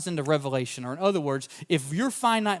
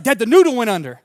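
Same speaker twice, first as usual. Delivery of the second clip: put-on louder, higher excited kid's cry; excited shriek; 6 semitones higher, 11 dB louder than usual. Son calling to dad from the side-by-side, excited shout. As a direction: imitate a boy yelling that the fish bit. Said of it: "my son kinda shrieks".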